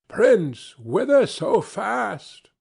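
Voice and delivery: high, slow, sly dwarf voice drawing out the question; a little mountain imp teasing a rider; ugly little dwarf. Acted. Dwarf on the rocks calls to the hurrying prince, high and sly.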